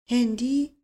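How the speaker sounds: hollow, echoing repeat with a rising question; dazed echo of the word, rising at the end; hollow voice from a well. A narrator's reading.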